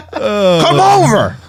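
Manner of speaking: high, drawn-out mock holler; stretched comic shout; all-caps dad. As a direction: yell the invite in capital letters. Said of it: "it's always in all caps".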